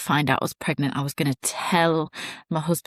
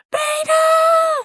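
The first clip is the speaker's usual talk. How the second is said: shrieked, much higher and louder; hysterical scream; 4 dB louder than usual. Retold or imitated. Wife shrieking at the positive test, hysterical scream. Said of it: "screamed out hysterically".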